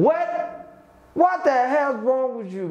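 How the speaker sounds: high, drawn-out indignant yell; acted angry outburst. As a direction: play someone losing it at a customer. Acts angry reply to a customer.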